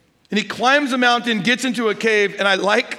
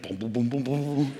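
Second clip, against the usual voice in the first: mock footsteps; 7 semitones lower, 8 dB quieter than usual. Toddler running back, footsteps.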